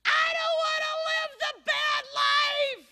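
put-on high, slow, drawn-out child's cry; scared boy pleading from the tree; wailing kid. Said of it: "He said".

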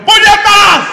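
high furious yell at a driver; angry shout. Retold or imitated. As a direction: blast the curse out loud. Car cuts him off.

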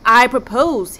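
"I propose" punched out loud with stress on "I"; loud emphatic restart. Acted. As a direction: hit "I" hard to seize the room. King repeats proposal loudly.

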